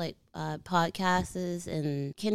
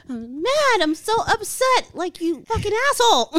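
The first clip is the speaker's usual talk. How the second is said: mock angry; 8 dB louder than usual. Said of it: "I can't tell if you're serious".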